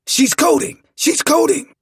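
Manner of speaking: high, loud doctor's alarm; urgent emergency yell; panicked shout. A narrator's reading. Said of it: "continued to talk in a panic".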